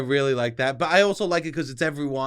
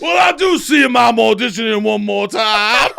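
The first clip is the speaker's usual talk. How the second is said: loud, laughing cartoon Southern bluster; audition-tape Southern character; 4 semitones higher, 10 dB louder than usual. Guest mocking their audition tapes, loud Southern cartoon voice. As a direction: belt out the Southern character while cracking up. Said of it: "Every S N L tape has that voice".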